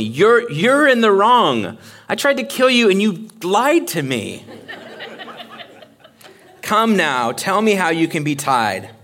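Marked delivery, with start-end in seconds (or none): 0.0-4.4 mock outraged Delilah. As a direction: overplay her offended accusation. Acting out Delilah's accusation.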